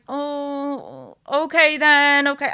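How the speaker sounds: slow, reluctant giving in; resigned agreeing. Retold or imitated. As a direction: sigh it out, giving in. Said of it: "I'm like".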